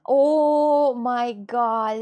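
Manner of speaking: slow, loud drawn-out wonder; awed. Real, not acted. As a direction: stretch it out in awe. Admiring the book.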